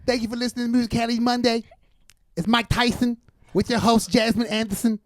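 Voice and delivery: high-pitched, lisping boxer impression; Mike Tyson. Impressionist thanking podcast listeners, high lisping boxer voice. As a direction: pitch it well up with a lisp. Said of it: "I can do a little Mike Tyson".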